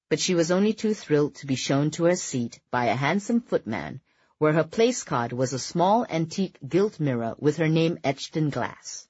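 ordinary delivery, nothing marked

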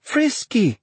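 high, sudden exclamation; thrilled high-voiced outburst; excited cry. A narrator's reading. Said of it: "she cried".

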